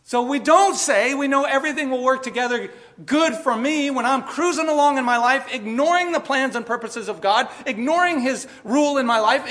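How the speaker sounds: loud, high sermon peak; preaching at full force. Real, not acted. Pastor warning the congregation, loud and high. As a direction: drive the warning home to the church.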